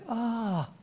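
soft, faded echo of the yell; distant echo. Retold or imitated. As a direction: answer back like a far-off echo. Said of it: "you could hear an echo".

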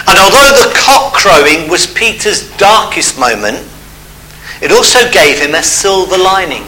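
ordinary talk

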